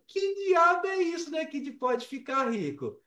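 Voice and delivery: pitched high in mock disbelief, as if rolling his eyes; incredulous. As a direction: scoff at the absurd title in disbelief. Incredulous reaction to YouTube video.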